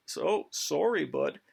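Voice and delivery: put-on a Canadian apologizing as he refuses surrender, in Canadian accent; lightly voiced Canadian, eh at the top; mock Canadian accent.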